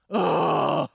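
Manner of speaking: put-on loud fed-up kid groan; loud whiny ugh of protest; kid's groan. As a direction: groan like a sulky boy. Kids groaning at mom's prompt.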